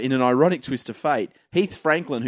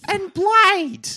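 much higher, slow, proudly listing names; bogan mum; 4 dB louder, much slower than usual. Retold or imitated. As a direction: play a proud mum announcing her kids to the room. Mum in crowd lists her kids.

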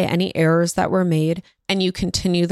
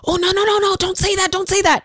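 high, flustered mock shushing; hammed-up embarrassed panic; mortified parent; 11 semitones higher than usual. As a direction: act out a parent frantically cutting a child off. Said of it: "we are just mortified and we run".

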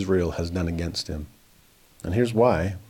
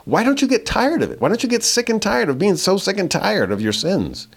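higher, louder, pressing the question; a rising exasperated why; exasperated plea; 11 semitones higher, 3 dB louder than usual. Real, not acted. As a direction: demand it in frustration. Exasperated rhetorical challenge.

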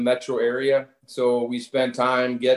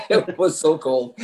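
chuckling through the words, a bit slower; laughing; 4 semitones higher than usual. Real, not acted. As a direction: laugh while remembering the cold. Guest laughs recalling freezing game.

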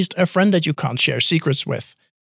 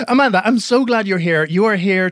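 higher, brighter put-on Canadian accent; mock Canadian accent; 5 semitones higher, 3 dB louder than usual. Host greets guest, jokingly in a Canadian accent. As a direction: playfully fake a Canadian accent. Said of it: "I'll do my best Canadian accent".

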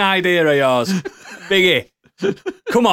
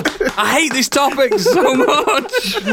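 slow, higher, laughing groan; horrified amusement; 7 semitones higher, slower than usual. Real, not acted. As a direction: laugh despite hating it. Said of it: "Why am I laughing?".

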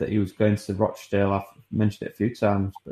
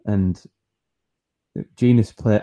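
ordinary talk

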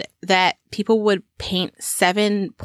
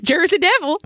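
high, hearty call-out; pitched-up, spirited catchphrase; with more heart; 8 semitones higher than usual. Pretend. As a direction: belt the catchphrase with gusto. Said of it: "With more heart".

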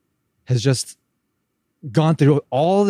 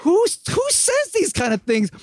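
incredulous. Real, not acted. Incredulous comment on the scene.

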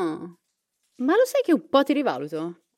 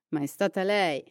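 normal talking voice

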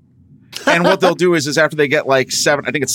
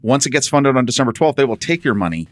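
normal talking voice

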